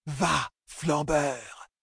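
high, very slow, steam-like wail; hissing cry. A narrator's reading. Three oracles crying together at Matt, hissing cry. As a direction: stretch each word out like escaping steam. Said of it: "crièrent d'une voix chuintante comme de la vapeur".

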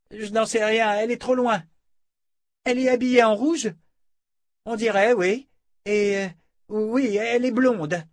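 high-pitched lookout reporting; calling back. A narrator's reading. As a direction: call the sighting across the post. Lookout calls back from her watch.

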